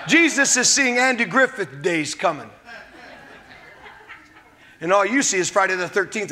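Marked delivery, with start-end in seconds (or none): none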